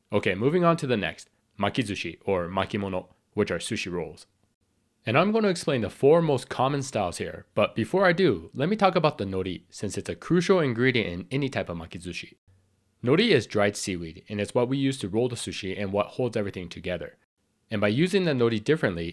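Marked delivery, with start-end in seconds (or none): none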